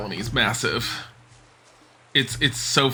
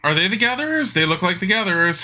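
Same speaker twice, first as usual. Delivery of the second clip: excited; 8 semitones higher, faster than usual. Real, not acted. Spotting NPCs in the game.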